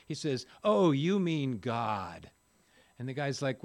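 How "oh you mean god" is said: slow, loud and disdainful, dragging out the word God; scornful drawn-out God. Retold or imitated. Sobered drunk mocking the bar guy's faith, slow and scornful. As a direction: sneer the name as if it disgusts you. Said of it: "why do you say it like that".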